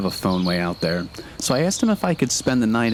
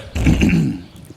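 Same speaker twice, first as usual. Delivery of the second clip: ghost throat clear; 5 semitones higher, 4 dB louder than usual. A narrator's reading. Ghost clears throat before punchline.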